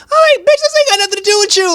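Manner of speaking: high, loud, fast rant; fed-up yell at Rachel; exasperated outburst. Real, not acted. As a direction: blow up at her, rapid fire. Fuming reply to Rachel's jab.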